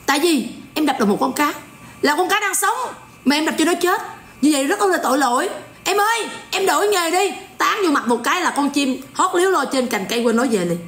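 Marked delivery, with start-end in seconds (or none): 5.8-7.3 lightly put-on sing-song monk, coaxing the fish seller; a gentle preacher's plea, half-mocking. A monk coaxing the fish seller to quit, in a lightly mocking preachy voice. Mimicking a monk's sermon voice.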